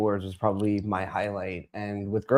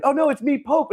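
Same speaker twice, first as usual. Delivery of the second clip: much higher, bright reveal; mimicking her reveal; 14 semitones higher than usual. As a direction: squeak it as her. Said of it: "she's like".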